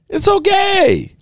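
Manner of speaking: very high, slow sarcastic it's okay; mock dismissive. Fed-up host mimicking the league's shrug at Rodgers. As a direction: sing it out like a shrugging official.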